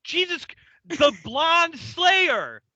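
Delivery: high, drawn-out exasperated cry; furious exasperation. Acted. Furious Bill reminding colleague about the blonde Slayer, exasperated. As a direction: spell it out, at the end of your patience. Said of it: "Bill is furious".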